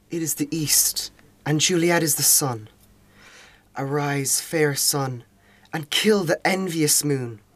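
lovestruck Romeo. Acted. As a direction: speak it like a young man in love. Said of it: "I'll just read an extract from Act Two, Scene Two, the balcony scene".

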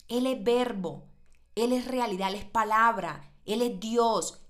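fervent preacher's proclamation; preaching emphasis. Real, not acted. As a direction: proclaim each line with force. Preacher builds emphatic climax.

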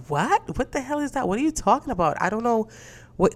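high-pitched retold bafflement; baffled disbelief. Retelling her reply to an angry friend, baffled. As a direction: act out an indignant "what are you on about".

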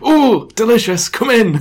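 put-on high, loud elderly voice; old man. Old judge invites visitor into the house, old man voice. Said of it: "I'll just do an old man voice".

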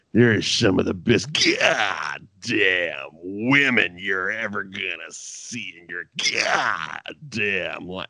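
slow, drawn-out sleazy barker; mimicking the Bordello of Blood wrangler; hilarious wrangler voice. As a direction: lure guys to the vampire whorehouse, slowly. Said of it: "the way he talked was hilarious".

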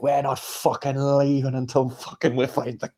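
slow Scottish hard-man line, imitated; Trainspotting quote. Quoting Trainspotting to friends, Scottish hard-man voice. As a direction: growl it like Begbie, slowly. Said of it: "in Trainspotting, he's like".

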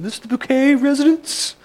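mock-posh, high and loud singsong phone greeting; hammed-up posh snob on the phone; posh accent. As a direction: answer grandly in a very high, put-on posh voice. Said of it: "in her posh accent".

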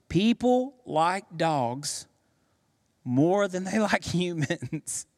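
laughing. Real, not acted. Punchline repeated with laughter.

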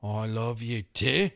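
attempted Scottish, rising at the end; Scottish that slips towards Australian; Scottish accent. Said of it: "can you do it in a Scottish accent".

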